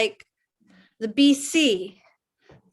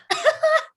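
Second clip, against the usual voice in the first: nervous giggle. Acted. Nervous laugh after confessing.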